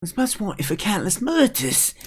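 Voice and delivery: put-on high, alarmed kid voice; alarmed young character. Kid facing a pike wanted for murders, alarmed.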